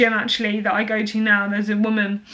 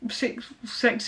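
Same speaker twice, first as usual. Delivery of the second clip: ordinary delivery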